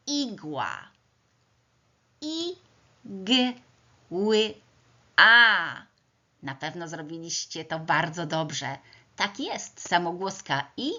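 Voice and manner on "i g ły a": "I, g, ły, a" as four separate high sounds; sounded out phonemes. Shown as a demonstration. Teacher sounding out the first word for pupils, phoneme by phoneme. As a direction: isolate each sound of "I, g, ły, a" with pauses. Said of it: "głoskuję pierwszy wyraz".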